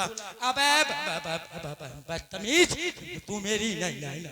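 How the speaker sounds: loud furious stammer on ब-; stammering station master scolding the traveller; angry stammer. Retold or imitated. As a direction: snap out बदतमीज after getting stuck. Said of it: "बड़े गुस्से में बोला".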